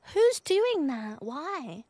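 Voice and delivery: can't believe anyone would do that; incredulous. Real, not acted. Streamer reacting to raw meat smoothies in chat, incredulous.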